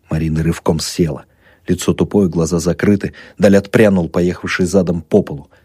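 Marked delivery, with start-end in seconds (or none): none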